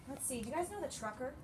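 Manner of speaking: normal talking voice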